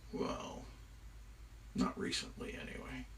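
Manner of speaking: very soft, hushed reply; sheepish admission. Acted. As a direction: own up reluctantly under your breath. Loki sheepishly admits to wickedness.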